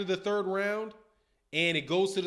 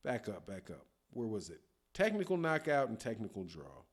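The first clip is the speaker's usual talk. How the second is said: low, much softer mutter while searching; muttering to himself; 10 semitones lower, 8 dB quieter than usual. Real, not acted. Host scrolling back through chat, quiet mutter. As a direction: mumble under his breath, scrolling.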